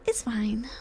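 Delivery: a hushed "it's fine"; sheepish whispered reassurance; whispered aside. Real, not acted. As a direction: whisper it to yourself. Whispers after admitting overspending.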